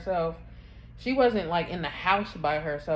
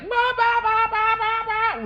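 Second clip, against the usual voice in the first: high, loud mock baby crying "bah bah"; imitating toddler wailing; 8 dB louder than usual. Mother imitates her daughter's loud wailing in the car. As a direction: mimic the daughter howling from the back seat. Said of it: "real loud".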